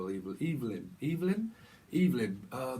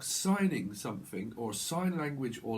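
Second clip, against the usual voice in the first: usual speaking voice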